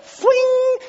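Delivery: high, drawn-out fling noise; mock fling sound. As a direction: voice the flick of the tract. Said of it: "it was a hand flick".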